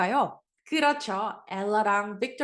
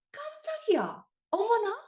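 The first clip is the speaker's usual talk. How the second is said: much higher startled exclamation; startled cry; 11 semitones higher than usual. Shown as a demonstration. Teacher acts the Korean surprise cry, high and startled. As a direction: act out a sudden fright, high and bright. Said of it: "이런 느낌으로 쓸 수 있는 표현이".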